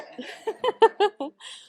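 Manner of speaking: high, loud burst of laughter; bright laugh. Real, not acted. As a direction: laugh at the joke. Host laughs after joke.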